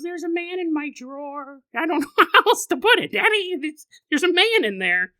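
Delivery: put-on a toddler insists to Daddy; insistent little girl. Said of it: "she goes".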